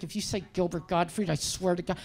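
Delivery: low, hushed threat under the breath; muttered warning. Retold or imitated. Comic warning friend's dad not to name Gottfried, muttered.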